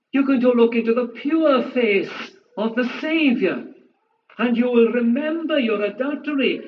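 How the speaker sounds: rising, pressing sermon appeal; fervent pulpit preaching. Acted. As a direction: press the congregation hard, preacher's urgency.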